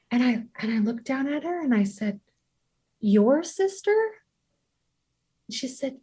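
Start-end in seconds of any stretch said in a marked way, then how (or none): 3.0-4.0 put-on gentle question to child. Mother asks toddler gently.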